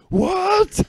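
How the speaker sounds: high, loud incredulous squeak; mock disbelief. Kid reacting to the hottest-person claim, high and loud. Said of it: "I was like".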